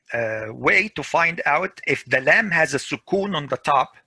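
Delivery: usual speaking voice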